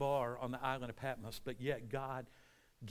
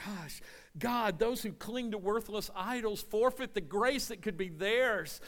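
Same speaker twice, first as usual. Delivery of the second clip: higher-pitched acted prayer; Jonah crying out; 6 semitones higher than usual. Jonah praying from inside the fish, crying out. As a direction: call up to God from the depths. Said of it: "he's crying out and he's praying".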